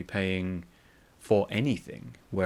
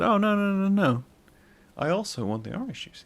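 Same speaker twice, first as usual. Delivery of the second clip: surprised protest; 11 semitones higher, 4 dB louder than usual. Retold or imitated. Protesting to the cashier.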